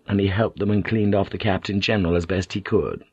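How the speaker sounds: plain reading voice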